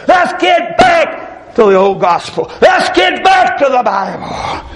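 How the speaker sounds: fiery street preacher shouting at the congregation; preaching at full force. Acted. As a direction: build the sermon into a shouting call.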